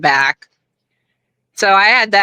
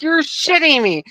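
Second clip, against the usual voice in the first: much higher, stunned retelling; mock disbelief; 10 semitones higher than usual. Guest mimicking Hogan's shocked disbelief, much higher. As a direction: imitate him floored by the news. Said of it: "He's like".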